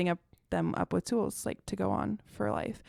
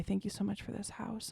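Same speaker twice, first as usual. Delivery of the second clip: hushed, softened little prayer; soft voice, imitating a whispered prayer; imitated whispering; 8 dB quieter than usual. Daughter praying alone with folded hands, imitated whispering. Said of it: "like, whispering".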